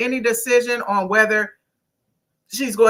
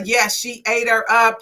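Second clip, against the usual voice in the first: ordinary delivery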